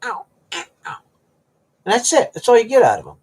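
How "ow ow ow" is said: cat imitation. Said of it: "You don't have a meow".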